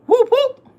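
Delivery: high loud whoop; imitating the sound of the hits, high and loud. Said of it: "all you heard was".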